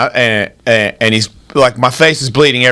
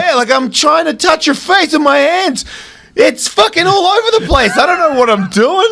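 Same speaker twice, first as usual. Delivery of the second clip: mock flail; 12 semitones higher than usual. Mocking his own flailing.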